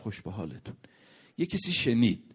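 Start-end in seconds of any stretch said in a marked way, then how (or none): 0.0-0.8 very soft and low; quiet, calm murmur to bystanders; softly, calmly. Retold or imitated. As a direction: say it low and hushed, barely carrying. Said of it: "آروم گفتا".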